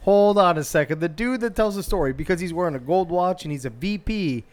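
acted outrage at a double standard; indignant objection. Co-host playing the accused employee objecting to managers, loudly indignant. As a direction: cut in loud and high, protesting.

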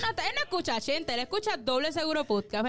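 usual speaking voice

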